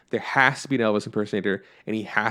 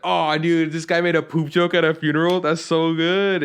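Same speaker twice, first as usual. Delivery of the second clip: delighted reaction; 6 semitones higher, 3 dB louder than usual. Retold or imitated. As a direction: gush like you can't believe he said it. Retelling delighted reaction to joke.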